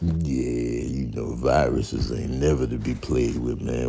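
low, menacing germ boss; a cool, threatening virus, pitched far down; deep virus voice. Acted. Virus character speaks.

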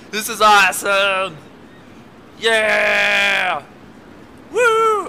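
loud, high whoops of delight; excited shouting. Real, not acted. Gamer whooping as the minecart speeds along, thrilled. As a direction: cheer at the top of your lungs.

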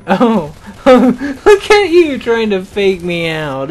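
high, loud, laughing mock taunt. Real, not acted. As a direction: laugh it out at the foe, loudly. Laughing at enemy's feint.

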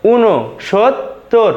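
"ঊনসত্তর" very slowly as u-no, shot, tor; slow correct pronunciation. Shown as a demonstration. Teacher showing students the correct pronunciation, slow and syllabic. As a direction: stretch "ঊনসত্তর" out in separated syllables. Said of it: "শুদ্ধ উচ্চারণ হয়ে যাবে".

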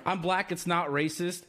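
ordinary talk

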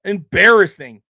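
a loud, disgusted "Embarrassing"; disgusted outburst. Real, not acted. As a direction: spit it out in disgust. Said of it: "Fucking embarrassing".

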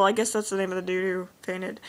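usual speaking voice

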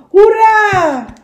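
high, loud call from far away; echo cheer. Acted. The echo calling back across the lake, far-off cheer. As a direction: ring out like an echo. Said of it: "En de echo riep terug".

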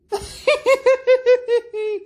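high mocking villain cackle; long, high, gleeful villain laugh. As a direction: cackle in triumph at the fools.

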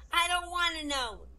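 put-on high, loud, slow childish wail; acted kid on the verge of bawling; tearful child. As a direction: wail it out in a little boy's voice. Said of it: "And he burst into tears".

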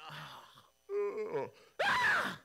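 sudden high shriek of horror; shocked scream. Retold or imitated. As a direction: groom waking to the wrong bride screams. Jacob wakes beside Leah.

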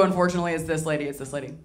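ordinary talk, nothing marked

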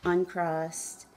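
measured meditation guide with long pauses; guided meditation. Acted. Guide asking listeners to follow the breath, guided meditation. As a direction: lead them gently through it, unhurried.

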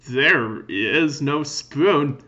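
slow drawn-out. Acted.

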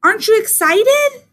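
high, loud, eager bird question; excited little bird, pitched high; chirping bird. A narrator's reading. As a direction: chirp it, swooping up at the end. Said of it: "Bird chirped".

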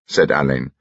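ordinary delivery

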